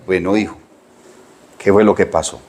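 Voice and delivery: calmado y suave, un poco más bajo; padre calmado. Retold or imitated. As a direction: habla al hijo con calma, sin enojo. Padre calmado habla al hijo. (padre tranquilo, voz suave y baja)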